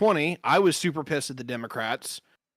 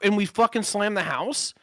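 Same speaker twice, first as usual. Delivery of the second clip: higher, lightly mocking voters' voice; mocking future voters; 6 semitones higher than usual. Mocking future voters who regret their choice, higher mock voice. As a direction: parody the people who finally caught on. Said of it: "and everyone's like".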